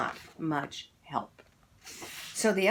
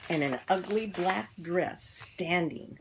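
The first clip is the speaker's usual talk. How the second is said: ordinary delivery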